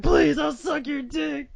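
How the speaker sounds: high, loud, desperate shouted plea; panicked begging. Retold or imitated. Retelling a cult member begging Nicolas Cage, mock panicked shout. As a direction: shriek it out begging for your life. Begging cultist quote, retold.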